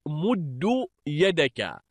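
مد said "muddu", short u after doubled d; damma ending. Shown as a demonstration. Teacher giving students a parallel verb, مد as "muddu". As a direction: end مد on "-du". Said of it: "جعله بمنزلة".

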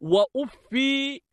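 وأفي ending in a long "ii"; long final ya. Shown as a demonstration. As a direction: say وأفي as "wa-uf-fii", holding the last vowel. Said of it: "بإثبات الياء".

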